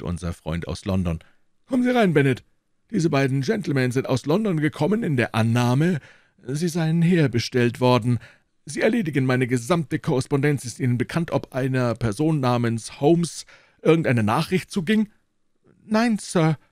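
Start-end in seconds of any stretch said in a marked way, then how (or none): 1.7-15.1 put-on a lofty old professor receiving guests; old professor's voice. A narrator's reading. Elderly professor politely greeting visitors, high and measured. Professor addresses his assistant Bennet. 15.8-16.6 high, small embarrassed reply; a flustered young secretary; blushing assistant. A narrator's reading. Assistant Bennet embarrassed answering his professor, high and meek. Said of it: "antwortete Bennet errötend".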